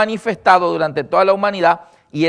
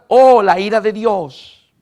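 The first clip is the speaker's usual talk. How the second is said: slow, loud mocking wail of doom; mock the people who cry divine wrath; mock lament; 3 semitones higher, 6 dB louder, slower than usual. Preacher mocking those who blame God, slow mock lament.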